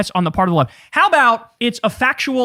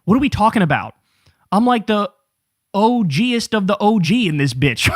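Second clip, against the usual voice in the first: ordinary talk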